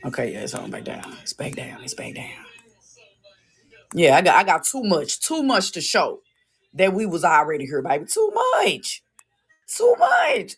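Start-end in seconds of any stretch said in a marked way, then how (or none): 0.1-2.3 whispered aside, barely voiced. Real, not acted. Talking to himself about the phone, in a whisper. 8.0-10.5 squeal. Real, not acted. Squealed, far higher.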